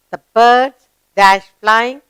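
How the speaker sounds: slow, deliberate reading that leaves a gap for the verb; slow gapped reading. Shown as a demonstration. Teacher reads the gapped sentence slowly to pupils. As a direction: read it slowly and pause at the blank. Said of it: "let's read the sentence".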